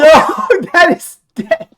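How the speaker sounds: loud, high shout through laughter. Real, not acted. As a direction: yell it out in disbelief. GM reacts to fatal roll.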